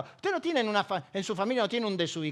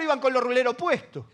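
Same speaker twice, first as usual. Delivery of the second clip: ordinary talk, nothing marked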